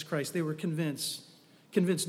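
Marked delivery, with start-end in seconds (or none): none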